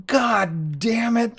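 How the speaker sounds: high, drawn-out exasperated cry; hammed-up retold yell, stretched slowly; mock yelling. Angry property owner after the windows get shot, mock yelling. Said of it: "He starts yelling".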